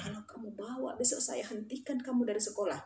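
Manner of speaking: low-volume, stern telling-off; hushed warning. Retold or imitated. Teacher warning students in class, hushed warning. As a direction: warn them under the breath.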